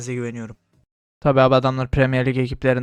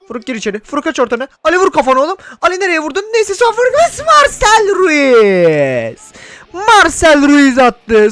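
excited shouting; 13 semitones higher, 9 dB louder than usual. Real, not acted. Cheering a goal in football game.

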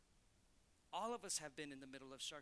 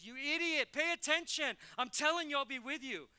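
loud, high, harsh rebuke; mock yelling; 9 semitones higher, 12 dB louder than usual. God scolding Moses, a mock furious yell. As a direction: bark it like an impatient scolding. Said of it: "God didn't start yelling at Moses".

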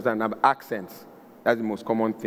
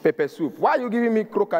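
indignant complaint; 8 semitones higher than usual. Retold or imitated. Customer complains to the waiter about the croaker, indignant and higher. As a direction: demand to know why you got croaker.

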